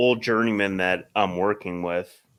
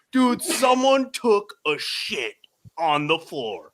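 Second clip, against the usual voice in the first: amazed report; 7 semitones higher, 5 dB louder, slower than usual. Retold or imitated. Movers reporting the mess.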